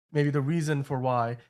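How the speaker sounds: normal talking voice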